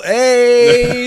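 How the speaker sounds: big ironic whoop; mock cheer. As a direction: cheer it like a sarcastic crowd. Cheering the car's arrival.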